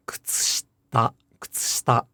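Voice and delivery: kutsushita with ku, tsu and shi all voiceless; fully devoiced. Shown as a demonstration. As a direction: whisper every vowel of kutsushita but the last a. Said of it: "if you devoice the".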